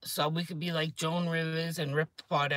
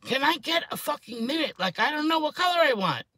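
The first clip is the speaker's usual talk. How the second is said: retold annoyance; 10 semitones higher, 4 dB louder than usual. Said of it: "I'm like".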